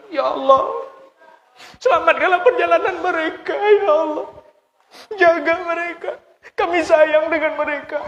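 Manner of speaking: high, wailing mock crying prayer; mock weepy prayer. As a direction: ham up a tearful farewell prayer. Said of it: "Pakai nangis pula mendoa".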